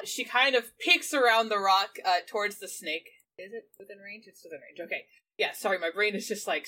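ordinary talk

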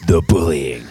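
mock-solemn voiceover; ominous, over-serious announcement; serious advert voiceover. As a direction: parody a grave advert narrator. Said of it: "really serious, non-ironic voice".

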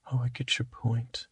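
unvoiced quick aside whisper. Aside reply.

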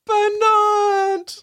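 gasping the name, very high; thrilled shriek of a name; excited gasp. Real, not acted. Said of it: "gasps at name".

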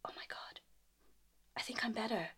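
awed whisper; voiceless, stunned realisation. Retold or imitated. Telling her friend the fear is gone, whispered.

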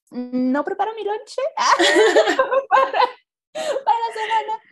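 tearful laughing wail; laughing in disbelief. Real, not acted. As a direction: laugh and whine at the absurdity. Retelling absurd job loss.

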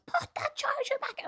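high, slow shriek of a frantic mum; replayed high-pitched panic at the kids; mock shriek. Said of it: "I actually shrieked at the top of my lungs".

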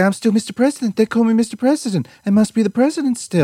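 high-pitched insistent protest; mock self-justifying president, pitched well up; Trump. Imagining a deposed president, indignantly insisting. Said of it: "He'll say".

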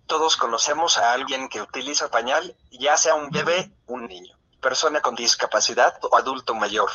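computer text-to-speech voice. Real, not acted. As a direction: read it like a synthesized voice. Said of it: "esta es mi voz sintetizada". (voz sintetizada de computadora)

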